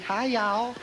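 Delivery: high-pitched imitation Texan greeting; mock Texan accent. Host teasing the Singapore lady by imitating her Texan accent. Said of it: "does that sound Texan?".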